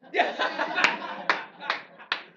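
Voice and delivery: breathy burst of laughter; amused laugh. Real, not acted. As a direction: laugh at your own joke. Teacher laughs at own joke.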